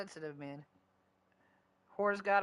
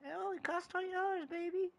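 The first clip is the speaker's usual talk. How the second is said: much higher, sing-song come-on voice; mock hustler pitch; 12 semitones higher, 3 dB quieter than usual. Mimicking a hustler offering himself to him, high teasing come-on. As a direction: play a street hustler cooing a price. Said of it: "I get".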